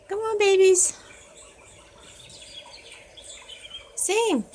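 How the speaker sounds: high, coaxing pet voice. Real, not acted. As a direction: sweet-talk the birds into singing. Coaxing birds to sing.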